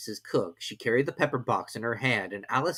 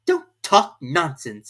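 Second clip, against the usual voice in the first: slow, louder retort; slow, firm girl's voice; bold Alice; 5 semitones higher, 8 dB louder, much slower than usual. A narrator's reading. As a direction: stand up to him boldly. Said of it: "said Alice more boldly".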